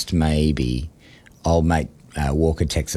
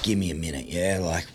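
lazy full crocodile; 9 dB quieter than usual. Pretend. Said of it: "This one's just like".

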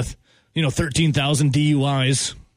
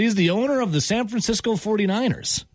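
explaining; 8 semitones higher than usual. Retold or imitated. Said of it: "I said".

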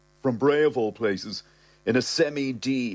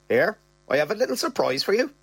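higher, quick, eager woman's voice; Bernie's voice; 9 semitones higher, faster than usual. Retold or imitated. Girlfriend teasing the men, higher eager voice. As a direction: tease the surprise.

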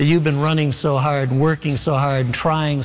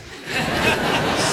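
high breathless laugh; 11 semitones higher than usual. Real, not acted. Laughs at his own story.

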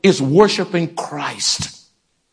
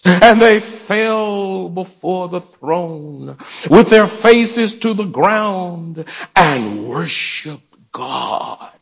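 slow, drawn-out reverent reading; solemn scripture reading; much slower than usual. Real, not acted. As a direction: linger on each phrase with solemn weight.

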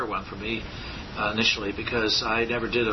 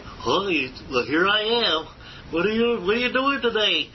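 louder, high country yokel voice; 10 semitones higher, 4 dB louder than usual. Retold or imitated. Early Big Bird as a yokel, high and loud. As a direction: drawl it like a country bumpkin. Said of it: "he talked like a yokel".

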